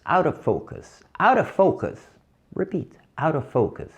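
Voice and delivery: ordinary talk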